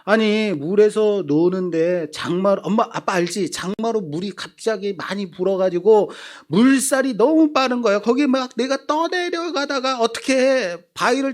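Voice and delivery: put-on high, pleading boy's voice spilling out excuses; son's excuse. Son explaining the lost glasses to dad, high and pleading. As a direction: play the son wriggling out of blame. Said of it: "아들이 핑계를 댑니다".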